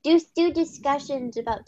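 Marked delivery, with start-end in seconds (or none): none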